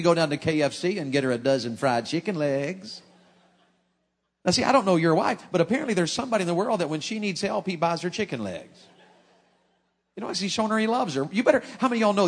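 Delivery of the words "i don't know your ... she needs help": ordinary talk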